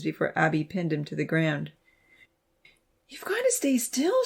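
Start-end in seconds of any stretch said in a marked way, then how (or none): 3.1-4.2 high, urgent plea; pleading. A narrator's reading. Abby begs injured Zach not to move, high plea. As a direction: beg him to stay still. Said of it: "she pleaded".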